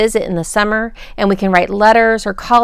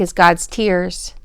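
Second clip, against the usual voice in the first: ordinary delivery, nothing marked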